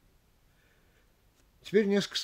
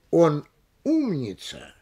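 пауза после «он», протяжное ироничное «умница»; выдели «умница» паузой и растяни с иронией; ироническое подчёркивание; slower than usual. Shown as a demonstration. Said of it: "надо особенно ярко подчеркнуть". (медленно, с кавычками в голосе на «умница»)

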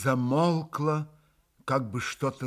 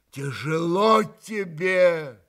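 slow, louder, words tearing loose; an uncontrollable cry of pity; unrestrained outburst; 4 semitones higher, 6 dB louder, much slower than usual. A narrator's reading. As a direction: let it break out of him, slowly. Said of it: "как-то совсем безудержно вырвалось".

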